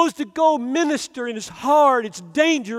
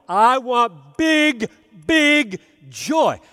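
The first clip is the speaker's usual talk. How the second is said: emphatic; 4 dB louder, slower than usual. Acted. Building emphasis on joy.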